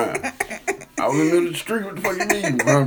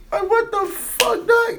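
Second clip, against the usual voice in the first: high, slow, incredulous exclamation; retold shocked outburst; slower than usual. Man retelling his shock at drunk uncle's request, incredulous yelp. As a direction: stretch it out in high-pitched disbelief.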